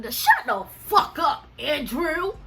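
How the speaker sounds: loud, high, drawn-out shut-down; angry telling-off. Retold or imitated. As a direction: shout him down with exasperation. Angrily telling off Andrew in story.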